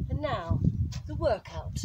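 loud, high showbiz reveal; grand announcement. Acted. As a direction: announce it like a star.

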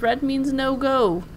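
ordinary talk, nothing marked

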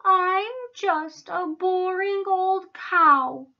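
high, slow, sorry-for-herself wail; poor cow. A narrator's reading. Miss Moo answers the zebras, sorry for herself. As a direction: bemoan it slowly and high. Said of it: "said poor Miss Moo".

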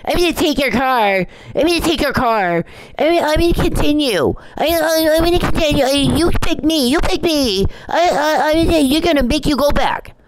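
high, squeaky mock voice; mocking Charles. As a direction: lampoon him as a giddy fool. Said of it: "And Charles wakes up".

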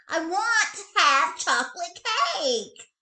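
high, loud whiny demand; little bunny demanding cake; insistent child. A narrator's reading. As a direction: insist like a spoiled kid.

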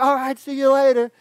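a hint of a little kid; cheerful kid. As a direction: chirp goodbye like a happy eight-year-old. Kid says goodbye.

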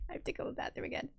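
rushed, unvoiced whisper. Real, not acted. Telling the stream viewers she needs the bathroom, quick whisper. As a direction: hurry the confession out in a whisper.

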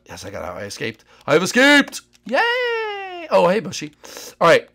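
usual speaking voice

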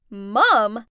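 loud incredulous shout; shouted shock. Acted. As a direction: disbelief from the group. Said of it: "all shouted".

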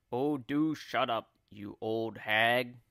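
lightly voiced grumpy husband; grumpy old man. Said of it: "Mister Twit said".

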